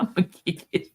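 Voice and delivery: words collapsing into real laughter; laughing. Real, not acted. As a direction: trail off helplessly into a laugh. Cracking up, cannot finish the threat.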